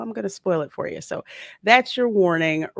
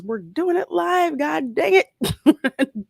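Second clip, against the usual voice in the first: higher, mock shouted clip quote; quoting a clip; 10 semitones higher, 4 dB louder, much slower than usual. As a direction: do the famous clip rant, drawn out at the end. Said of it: "one of the best clips ever".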